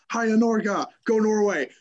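cheering in a put-on Norwegian accent. Shown as a demonstration. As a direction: show off a playful Norwegian accent. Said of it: "I'm saying it in a Norwegian accent".

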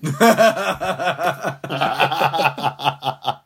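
punchline delivered as laughter takes over; can't hold it in; laughing. Real, not acted. Comedian cracking up at his own punchline, laughing.

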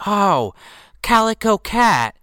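high, loud, drawn-out cooing; hammed-up high receptionist voice; cat lady receptionist.

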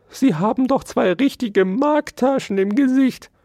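very high, loud squeaky protest; plaintive mouse. A narrator's reading. Mouse excuses itself to lieber Herr Dauerspeck, whining.

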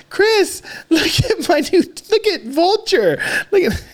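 put-on high-pitched child showing off a toy; very high, eager kid voice; excited little kid. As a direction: imitate a small boy demanding attention. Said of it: "He's like".

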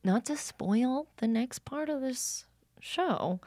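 slow teasing whisper. Real, not acted. To the listeners, hinting at the next part in a slow whisper. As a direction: lean in and whisper the tease. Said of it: "whispering a teaser".

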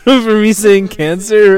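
laughing outraged shout. Real, not acted. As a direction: shout it in amused disbelief. Outraged joke after laughing.

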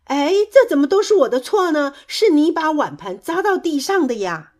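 high, loud protest; indignant retort. A narrator's reading. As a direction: argue straight back. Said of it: "跳跳立刻反驳".

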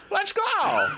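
very high, squeaky put-on rallying cry; cartoonishly high hero catchphrase; trying out a voice. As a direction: push it way up into a silly falsetto. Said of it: "I'm gonna use that voice".

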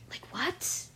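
breathy disbelieving what; incredulous whisper. Real, not acted. Incredulous at tax.

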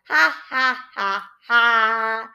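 loud, hammed-up group laugh; a stagey ha ha ha ha; a chorus of wishers laughing at the chameleon; mock laughter. A narrator's reading. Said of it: "All of the wishers laughed".

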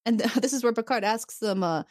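ordinary talk, nothing marked

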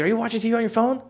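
high-pitched nosy question; snitching brother, imitated. Brother catches him watching on phone, high mocking imitation. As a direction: mimic a prying brother. Snooping brother voiced in story.

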